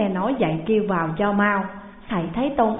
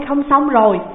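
loud, higher, panting alarm; breathless alarm; 5 semitones higher, 7 dB louder than usual. Acted. Disciple gasps out the bad news, loud and breathless. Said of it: "thở hào hển nói rằng".

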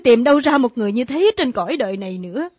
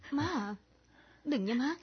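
soft plea; 12 dB quieter than usual. Acted. Pleading softly with mother.

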